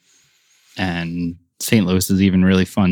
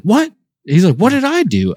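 squeaky high startled imitation of Andy; mock innocent Andy; 13 semitones higher than usual. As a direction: play Andy caught off guard, protesting innocence. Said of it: "And he's like".

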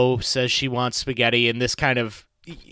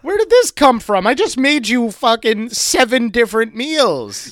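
mock outraged shock; 8 semitones higher, 6 dB louder than usual.

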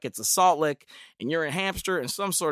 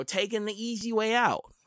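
ordinary talk, nothing marked

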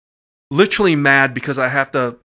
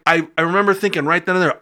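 normal talking voice

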